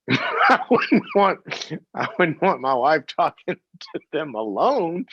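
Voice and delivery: high laughing aside. Real, not acted. Host joking to caller, laughing. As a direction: laugh through the joke. Laughing at serial killer joke.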